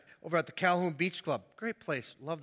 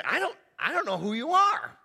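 higher, louder put-on "I don't know who you are"; mock owner; 6 semitones higher, 5 dB louder than usual. Homeowner answering an unknown knocker, put-on voice.

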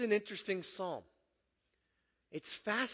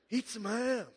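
movie-line impression; 5 semitones higher than usual. Preacher reminds the room of Napoleon Dynamite, light movie-line impression. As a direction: deliver it as a quoted film line. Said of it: "You get Napoleon Dynamite".

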